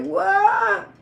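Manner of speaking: loud, high, startled what; shocked what. Retold or imitated. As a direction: blurt it out in shock. Shock at his confession.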